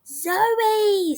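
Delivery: high, drawn-out cry of surprise; storyteller's high-pitched "zowie!"; startled exclamation. A narrator's reading. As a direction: exclaim it high, as if hit by a stink. Child exclaims at a horrible stench.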